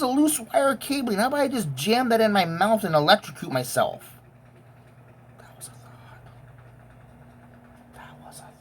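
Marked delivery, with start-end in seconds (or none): 5.4-8.5 breathy whisper, almost no voice. Real, not acted. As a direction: let it slip out under your breath. Dark confession, half-voiced aside.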